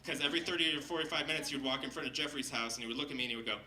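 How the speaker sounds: usual speaking voice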